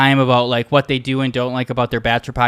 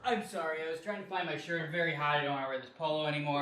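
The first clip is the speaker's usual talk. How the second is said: talking from across the room; far from mic; 19 dB quieter, much faster than usual. Real, not acted. Co-host apologizing while looking for a shirt, distant. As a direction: call out from off mic.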